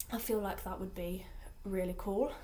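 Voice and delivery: breathy unvoiced whisper; whispered aside. Real, not acted. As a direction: whisper it like a secret confession.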